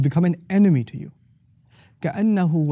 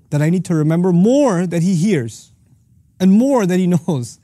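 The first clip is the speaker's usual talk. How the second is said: "more" stretched out and swelling both times; emphatic; 3 semitones higher, 3 dB louder than usual. Shown as a demonstration. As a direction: lean hard on each "more", then fall away. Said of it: "He's emphasizing it even more".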